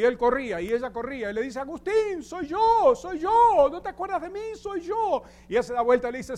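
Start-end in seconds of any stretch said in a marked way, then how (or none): 1.6-5.2 high-pitched eager woman's voice; pleading woman. Retold or imitated. Prostitute running after Agustín begging him to remember, high pleading voice.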